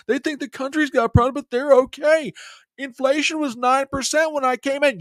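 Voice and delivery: high, fast sing-song mock; mocking the president. Host mocking the president's rosy claims in a silly voice. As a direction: parody him as smug and airy. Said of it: "try and convince everybody".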